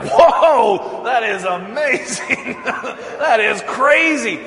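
high, loud amazed shout with laughter. Retold or imitated. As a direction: cry out in wonder, laughing. Crowd amazed at fire.

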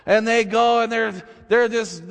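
ordinary talk

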